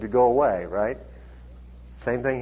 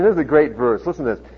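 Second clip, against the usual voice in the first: ordinary talk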